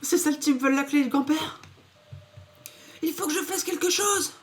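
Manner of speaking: put-on high, worried little girl; squeaky girl voice, worried; young girl voice. (voix de petite fille inquiète)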